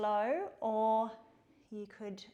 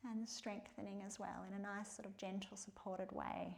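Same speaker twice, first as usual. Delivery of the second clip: soft spa whisper; 3 semitones lower, 10 dB quieter than usual. Real, not acted. Gentle yoga cue.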